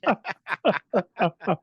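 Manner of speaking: a bright high burst of laughter. Real, not acted. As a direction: laugh out loud at the joke.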